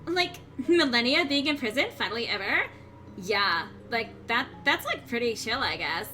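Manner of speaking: put-on bubbly valley girl voice, lots of like; Valley Girl accent. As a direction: play it like a bored mall girl. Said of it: "Valley Girl accent".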